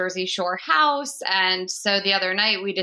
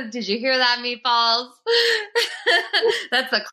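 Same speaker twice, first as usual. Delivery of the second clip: laughing; 7 semitones higher than usual. Real, not acted. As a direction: talk through real laughter. Host cracks up after guest's line.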